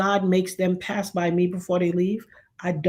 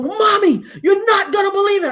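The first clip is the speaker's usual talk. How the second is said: high, loud, breathless with shock; excited daughter; 10 semitones higher, 5 dB louder than usual. Retold or imitated. Daughter running to the banister to tell her mother, high and excited. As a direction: burst out with the news.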